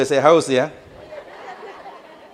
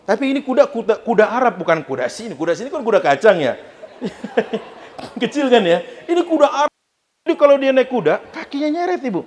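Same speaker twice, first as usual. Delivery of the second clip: excited joke; 7 semitones higher than usual. Acted.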